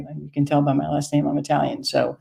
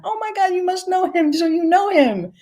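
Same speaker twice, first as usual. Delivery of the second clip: very high, thrilled squeal; excited high old lady. Retold or imitated. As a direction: squeal it out as if overjoyed. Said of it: "she gets even more excited".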